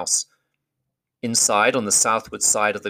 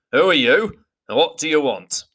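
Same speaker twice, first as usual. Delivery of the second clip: louder, higher gruff challenge; 7 semitones higher, 4 dB louder than usual. A narrator's reading. Beorn challenging strangers at his door, gruff. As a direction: bark the question at the strangers. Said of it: "he asked gruffly".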